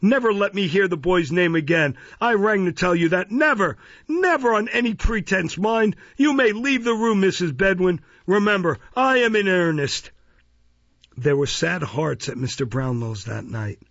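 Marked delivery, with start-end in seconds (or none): 0.0-10.1 higher and louder, a stern show of anger he doesn't feel; feigned anger. A narrator's reading. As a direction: forbid the housekeeper to speak of the boy, mock-stern. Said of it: "feigning an anger he was far from feeling".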